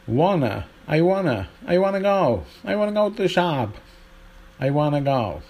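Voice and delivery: "wanna" run together with no t, American accent; American contraction. Shown as a demonstration. Teacher demonstrating contractions, put-on American "wanna". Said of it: "we hear contractions like".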